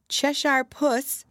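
high, slow, tentative call; mildly timid girl's address. A narrator's reading. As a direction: call the cat carefully, pitched high. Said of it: "she began rather timidly".

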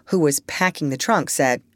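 usual speaking voice

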